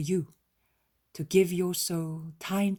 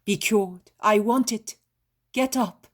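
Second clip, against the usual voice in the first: commanding louder shout; 4 semitones higher, 9 dB louder than usual. A narrator's reading. Jesus shouting a healing command over the old woman. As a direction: command the healing with full force. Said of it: "He shouts".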